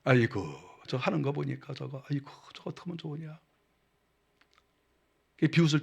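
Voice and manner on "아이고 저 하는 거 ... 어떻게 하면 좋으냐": soft, quick tutting sneer; sneering mockery. Preacher acts out someone sneering at another, mocking. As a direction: mutter a contemptuous aside. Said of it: "비웃는 거예요".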